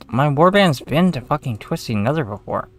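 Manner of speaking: fed-up gamer ranting at the screen; exasperated outburst. Real, not acted. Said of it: "I'm getting really aggravated".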